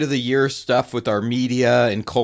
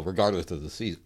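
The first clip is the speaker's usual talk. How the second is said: talking through a mouthful of food; words mumbled around a bite; mouth full; 5 semitones lower, 8 dB quieter than usual. Real, not acted. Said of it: "I'm talking with my mouth full of food".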